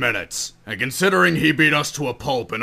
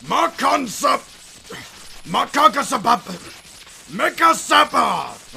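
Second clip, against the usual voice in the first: high, strained attack call; high-pitched, halting yell; fumbled battle cry; slower than usual. Acted. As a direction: shout a tongue-twister and stumble over it.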